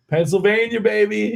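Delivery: high, loud cheer of Pennsylvania pride; a stranger hyped by the shirt, calling out. Retold or imitated. Passerby on the Strip hollering at his shirt, loud high cheer.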